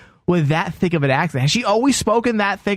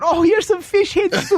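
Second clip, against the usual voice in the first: Tony's mom impression. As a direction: do the mom offering soup, much higher. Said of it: "I would love a bit with you and Tony's mom".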